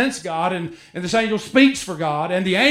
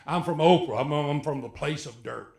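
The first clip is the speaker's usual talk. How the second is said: mock hometown; 5 semitones lower, 5 dB quieter than usual. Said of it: "I mean".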